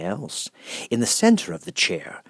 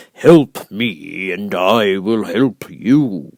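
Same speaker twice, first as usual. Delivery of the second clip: booming, much louder, very slow voice of the giant head; great and terrible Oz; 10 dB louder, slower than usual. Acted. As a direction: loom over her, slow and thundering. Oz lays down his terms.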